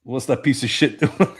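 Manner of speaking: a hint of a tough detective; lightly voiced action-movie cop; gruff movie-cop voice. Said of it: "he's like".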